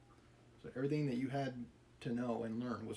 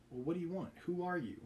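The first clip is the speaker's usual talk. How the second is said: shouted; 6 semitones higher than usual. A narrator's reading. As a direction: demand to know who he is. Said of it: "the workman shouted".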